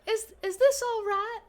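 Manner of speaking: high, bright-eyed barmaid greeting; lilting saloon barmaid welcome; sweet bartender voice. Acted. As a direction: greet the customer in a sugary high voice. GM voicing bartender.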